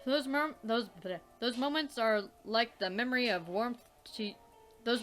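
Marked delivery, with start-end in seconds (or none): none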